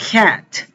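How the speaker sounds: "cat" with a fronted vowel between "ah" and "eh". Shown as a demonstration. Teacher modelling "cat" with A between A and E. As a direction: say "cat" as "kyat", vowel leaning to E. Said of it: "una A que es entre A y E".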